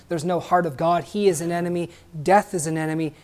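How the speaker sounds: normal talking voice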